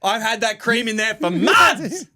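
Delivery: villain mum. Retold or imitated. Mum voiced as poisoning villain.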